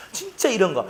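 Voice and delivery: normal talking voice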